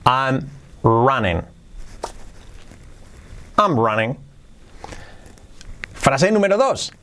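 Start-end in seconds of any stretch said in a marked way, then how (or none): 0.0-1.4 slow, word-by-word teaching take; careful slow dictation; slow model. Shown as a demonstration. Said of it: "luego un poquito más rápido". 3.6-4.2 joined-up, brisker take; fluent second take; quicker repeat. Shown as a demonstration. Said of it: "un poquito más rápido que lo repite".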